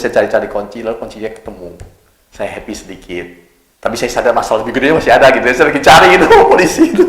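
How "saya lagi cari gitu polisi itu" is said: high, loud laugh through the words. Real, not acted. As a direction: laugh while telling it. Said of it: "laughing retelling". (tertawa sambil bercerita, nada tinggi)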